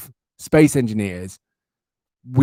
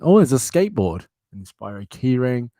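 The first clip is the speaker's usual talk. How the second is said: ordinary talk, nothing marked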